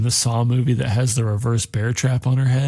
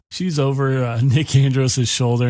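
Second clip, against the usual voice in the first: ordinary delivery, nothing marked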